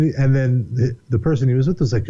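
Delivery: normal talking voice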